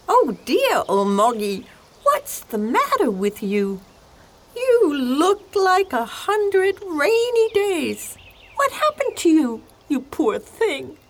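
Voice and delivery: very high, concerned donkey voice. Acted. Donkey asking an unhappy cat what's wrong, high-pitched. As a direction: fuss over a poor creature in a high donkey voice.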